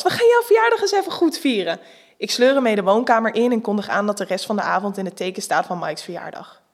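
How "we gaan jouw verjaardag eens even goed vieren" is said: excited shout. Acted. Excitedly planning birthday party.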